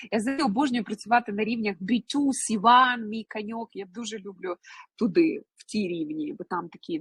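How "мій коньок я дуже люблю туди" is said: normal talking voice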